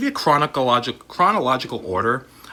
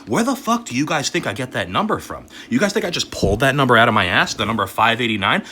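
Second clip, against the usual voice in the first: ordinary delivery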